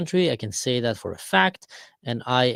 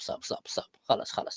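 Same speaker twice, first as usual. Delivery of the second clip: whispered stop, stop; hushed pleading; 3 semitones lower, 10 dB quieter than usual. Acted. Saudi Arabia hushing Israel, whispered pleading. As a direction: whisper urgently for it to end.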